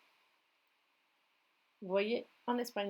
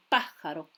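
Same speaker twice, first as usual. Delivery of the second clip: pájaro stressed firmly on its first A; stress on first syllable; 5 semitones lower, 5 dB louder than usual. Shown as a demonstration. Teacher showing students the tonic accent, pájaro stressed on the first A. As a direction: put the weight on the PA of pájaro. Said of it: "L'accent tonique se situe sur le A accentué".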